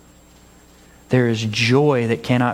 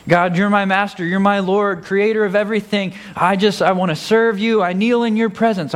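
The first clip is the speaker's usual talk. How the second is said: mock worship; 6 semitones higher than usual. Hammed-up worship to God.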